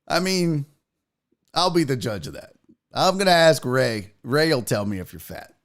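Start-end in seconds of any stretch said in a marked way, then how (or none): none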